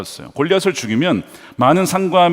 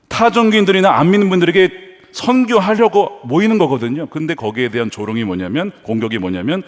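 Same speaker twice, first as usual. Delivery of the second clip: usual speaking voice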